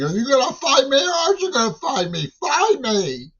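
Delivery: very high, fast, loud shriek; high screechy taunt, imitating others; imitated shrieking. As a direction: shriek it in a mock-frantic squeal. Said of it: "start shrieking".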